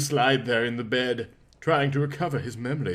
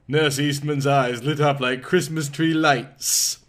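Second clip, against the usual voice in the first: ordinary delivery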